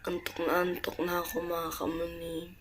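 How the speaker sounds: soft, sleepy and hoarse; quiet sick late-night voice; hushed. Real, not acted. (mahina at paos na boses)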